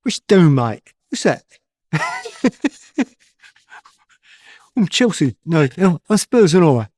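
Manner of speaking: loud Cockney impression asked for by the host; Cockney accent. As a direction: give it a cheeky Cockney swagger. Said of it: "can we have a snippet of that accent now".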